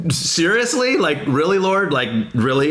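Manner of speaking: half-laughing exasperation; exasperated plea to God. Retold or imitated. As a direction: look up and ask heaven if it's serious. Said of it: "I'm looking up".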